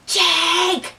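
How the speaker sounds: high, drawn-out call of a name; urgent call. Retold or imitated. Calling friend from the stairwell door, high urgent call. Calling for Jake, second try.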